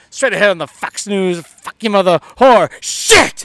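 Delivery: rising into a loud curse; sudden cursing outburst. Retold or imitated. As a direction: start smooth like a newsreader, then blurt the curses. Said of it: "And he goes".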